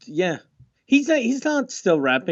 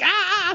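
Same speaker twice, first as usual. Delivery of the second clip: high-pitched comic scream of fright; mock terrified scream; 14 semitones higher than usual. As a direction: shriek in pretend terror. Acting [name] scared in studio.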